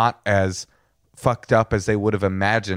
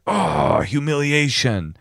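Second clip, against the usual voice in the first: higher, slow mock-hurt "humiliation"; mock wounded sigh; 6 semitones higher, much slower than usual. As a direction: act stung, stretch the word out. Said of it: "And the guy's like".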